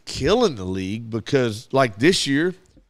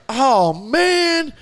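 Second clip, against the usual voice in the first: much higher and louder groan of disappointment; disappointed kid; 11 semitones higher, 4 dB louder than usual.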